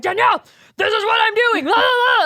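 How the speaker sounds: hammed-up excited husband, high and loud; Garrett. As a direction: imitate his amped-up shouting and babble. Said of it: "typical Garrett fashion, which is, that's exactly".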